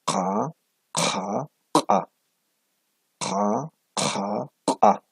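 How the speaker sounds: "Ka" said slowly, each one crisp and separate; repeating ka sounds. Shown as a demonstration. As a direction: model the ka consonants for students. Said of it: "Una vez más, a ver". ("ka" repetido con cuidado, como modelo)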